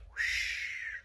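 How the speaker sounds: soft, slow whispered whoosh; whispered sound effect. A narrator's reading. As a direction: hush the doors closing. Doors slide shut.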